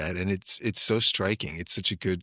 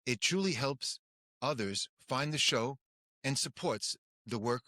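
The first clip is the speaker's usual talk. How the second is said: soft, higher announcer read; podcast outro; 4 semitones higher, 8 dB quieter than usual. Acted. Host to listeners, a soft announcer read.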